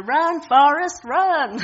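shouted "Run, Forrest, run!", high and loud; calling out. Retold or imitated. Pastor voicing Jenny urging Forrest, loud cheering call. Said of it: "the famous line".

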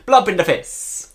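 put-on slow, hammy Italian accent; drawn-out mock Italian accent. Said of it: "That was my Italian accent".

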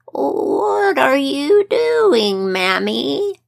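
put-on slow creaky old man voice. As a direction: drag it out like an ancient man. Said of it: "with the voice of a very old man".

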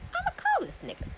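high-pitched, indignant resolve; high, mock-serious announcement of a decision; determined. Woman decides to call the man who went silent, determined.

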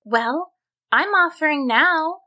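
high-pitched, matter-of-fact reply; helpful heroine. A narrator's reading. Susan answers the crying troll, high helpful voice. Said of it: "Susan responded".

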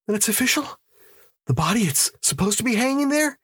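very high, quick questioning; high-pitched astonished query; incredulous hopeful question. Acted. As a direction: ask in anxious hope for confirmation. Hopeful man seeking confirmation from cop.

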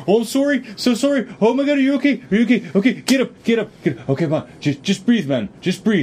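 high-pitched, frantic mock apologizing; hammed-up worried fussing; imitated panicked apology. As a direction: act out a fretting, over-sorry driver rushing to help. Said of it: "He comes running up, he's like".

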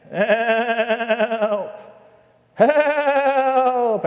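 high, loud bleating cry of "Help!"; sheep impression. Sheep stuck in a hole bleats for help in a high, loud bleat. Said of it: "starts yelling".

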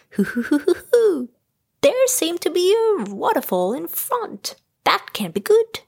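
high-pitched cartoon character voice; Tigger. A narrator's reading. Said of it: "announced Tigger".

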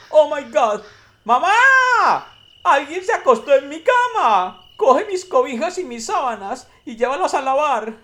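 very high, loud squealing cub voice; baby bear. Acted. Baby bear calls mama about his bed, very high loud squeal. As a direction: squeal it like an outraged little child. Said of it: "desarreglada y dijo".

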